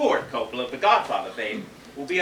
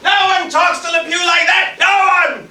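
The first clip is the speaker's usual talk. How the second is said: much higher and louder self-proclaiming roar; furious shout; 10 semitones higher, 8 dB louder than usual. Acted. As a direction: bellow it like a wounded diva director. Director erupts at insult.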